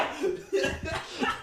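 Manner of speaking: high breathy maniacal cackle; evil villain laugh. Shown as a demonstration. Voice tutor ending Plankton with a laugh. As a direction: cackle like a tiny mastermind. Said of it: "stretch out your words".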